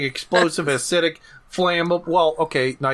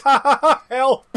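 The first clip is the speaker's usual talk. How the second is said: higher mock laughing cry for help; hammed-up "ha ha" into a plea; imitated laughing plea; 10 semitones higher, 5 dB louder than usual. Quoting a character's laughing cry.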